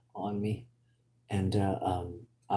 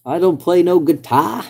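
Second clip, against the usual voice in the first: hammed-up hillbilly grammar; 8 semitones higher, 8 dB louder than usual. Repeats with double negative.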